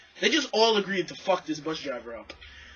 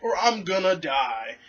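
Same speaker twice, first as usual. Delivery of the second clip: diabetic woman; 7 semitones higher than usual. Retold or imitated. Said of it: "And she's like".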